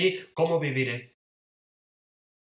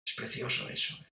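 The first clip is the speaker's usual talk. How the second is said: whispered aside; 10 dB quieter than usual. Real, not acted. Preacher moved by the scene.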